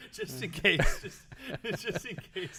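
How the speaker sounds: high-pitched words squeezed out through laughter; laughing. Real, not acted. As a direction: try to say it while cracking up. Cracking up after own warning.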